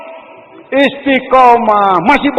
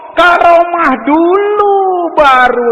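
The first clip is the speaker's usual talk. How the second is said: tearful; 6 semitones higher, 3 dB louder, much slower than usual. Real, not acted. Emotional sermon point.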